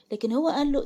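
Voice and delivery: plain reading voice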